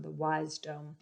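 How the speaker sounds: "wisdom" said softly as WISE-DOME; quiet "wisdom" with a long i, wize-dohm; wise-dom pronunciation. Shown as a demonstration. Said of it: "the ones that are of the wise".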